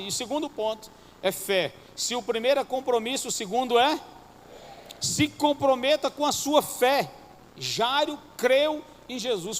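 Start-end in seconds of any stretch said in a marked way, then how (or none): 5.0-9.6 drawn-out, higher exhortation; slow preaching. Acted. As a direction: press each word on the church. (pregação lenta e enfática)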